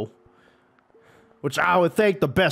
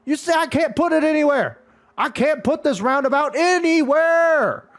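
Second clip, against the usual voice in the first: high-pitched real outburst of frustration; exasperated yelling; 12 semitones higher, 4 dB louder than usual. Real, not acted. Gamer can't place the roundabout, exasperated yelling. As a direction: wail the complaint, stretching the final word.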